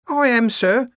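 high-pitched servant woman; worried cook. A narrator's reading. The cook answers the visiting wizards, worried. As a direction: pipe up high as a deferential cook. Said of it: "said the cook".

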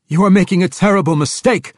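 fast, high, loud roar; roared protest. A narrator's reading. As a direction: roar a desperate warning at your captor. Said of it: "the drow prisoner roared".

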